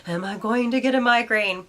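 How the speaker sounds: anxious worry. Pretend. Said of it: "a mess of nerves".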